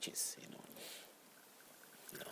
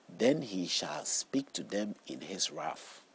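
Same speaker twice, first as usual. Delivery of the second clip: slow, louder proclaimed verse of wrath; solemn scripture reading; 7 dB louder, slower than usual. Acted. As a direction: declaim the verse like God's warning. Reads Psalm aloud.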